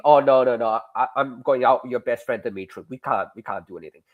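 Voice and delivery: put-on Russian accent. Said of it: "thank you both for your Russian accent".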